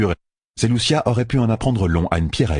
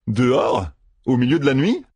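questioning, rising to a higher pitch; incredulous echo; 5 semitones higher than usual. Acted. Matt questioning an outing at night, incredulous. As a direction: throw the word back in disbelief.

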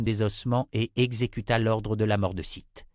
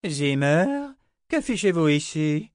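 loud, high, indignant question; startled old wizard; 7 semitones higher, 5 dB louder than usual. Acted. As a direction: demand it as a baffled old man.

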